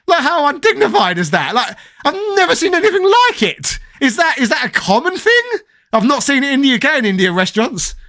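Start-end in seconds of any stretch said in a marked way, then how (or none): 0.1-5.4 much higher, laughing through the words; laughing disbelief. Real, not acted. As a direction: laugh at how absurd it was. Laughing at absurd restaurant story.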